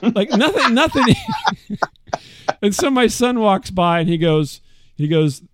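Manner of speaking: usual speaking voice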